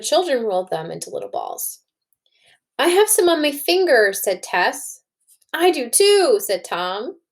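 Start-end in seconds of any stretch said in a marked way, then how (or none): 2.8-4.1 put-on high, bright child's voice; little girl. Teacher voicing Tess with sticky fingers, high. 5.5-6.4 put-on high, bright child's voice; little boy. Teacher voicing Tom agreeing, high.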